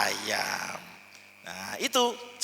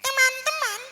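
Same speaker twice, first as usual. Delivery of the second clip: small voice. Shown as a demonstration. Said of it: "tekan dari dalam, tinggikan nadanya sedikit".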